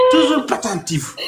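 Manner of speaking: high, rising mock sermon; mock preaching. A comic preacher proclaiming truth, in a high, fervent voice. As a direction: preach it like a revival, voice climbing.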